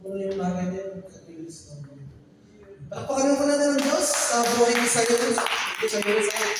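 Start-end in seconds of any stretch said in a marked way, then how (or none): 2.9-6.5 raised, rousing call for applause. Real, not acted. Preacher rousing the congregation to applaud God, raised voice. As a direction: lift the room into clapping.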